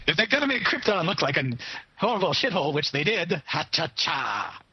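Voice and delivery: put-on milk it like an old Catskills stand-up with a vaudeville accent; vaudeville comic accent. Joking to his co-host, hammed-up vaudeville comedian accent. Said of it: "take off the vaudeville accent".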